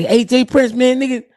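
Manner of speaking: hyped caller. Retold or imitated. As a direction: rush in all worked up.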